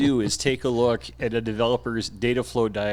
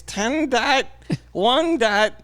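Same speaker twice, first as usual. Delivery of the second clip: slow, high clueless developer; mock developer voice; 11 semitones higher, 3 dB louder, much slower than usual. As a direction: play a confused developer. Mocking a developer caller.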